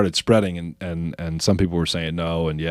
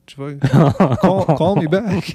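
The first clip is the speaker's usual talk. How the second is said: laughing; 8 semitones higher, slower than usual. Retold or imitated. Friend's hurt voicemail.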